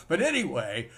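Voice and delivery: normal talking voice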